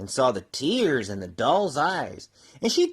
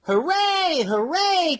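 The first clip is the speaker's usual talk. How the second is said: cheer; 11 semitones higher, slower than usual. Acted. Clown cheering at the top of the mountain, joyful shout. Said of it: "cried the funny little clown".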